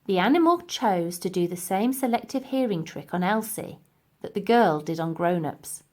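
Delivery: ordinary delivery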